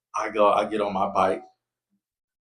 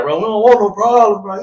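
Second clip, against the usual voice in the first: placating; 11 semitones higher, 11 dB louder than usual. Retold or imitated. Cousins backing down, loud high.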